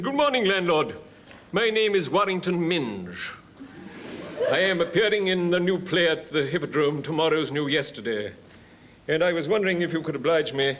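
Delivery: put-on hammy old thespian asking a favour; old touring actor. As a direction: play an old-school actor announcing himself. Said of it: "a story about an old boy who's on tour".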